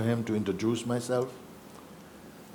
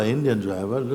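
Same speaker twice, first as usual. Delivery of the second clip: ordinary talk, nothing marked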